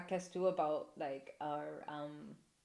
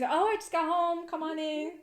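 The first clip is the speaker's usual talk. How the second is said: higher, louder chirpy excuse; fake sweet excuse; 9 semitones higher, 5 dB louder than usual. Dodging an unwanted visitor at the door, high and chirpy. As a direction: brush the caller off sweetly.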